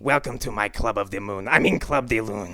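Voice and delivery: Christopher Lambert voice. Retold or imitated. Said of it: "Christopher Lambert".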